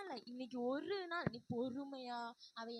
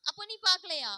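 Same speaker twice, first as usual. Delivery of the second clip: high, very loud accusation; shouting back; 5 semitones higher, 11 dB louder, faster than usual. Acted. Younger sister yelling an accusation at her sister. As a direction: yell it at her.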